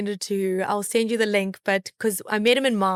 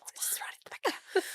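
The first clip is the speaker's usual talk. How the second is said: 1 whispered; 8 semitones higher, 12 dB quieter than usual. Retold or imitated. Declining front seat, whispering.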